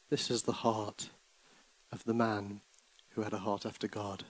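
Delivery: rhythmic, rising and falling recital; dramatic poem reading. Acted. Preacher reading a poem to church, dramatic. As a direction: read the ballad like a storyteller.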